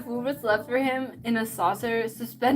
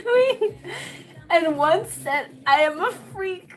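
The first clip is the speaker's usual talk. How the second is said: stifled giggles; 6 semitones higher, 3 dB louder than usual. Real, not acted. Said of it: "trying not to laugh".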